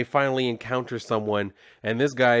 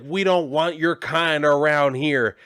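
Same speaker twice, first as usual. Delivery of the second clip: mock angry mob; 4 semitones higher, 5 dB louder, slower than usual.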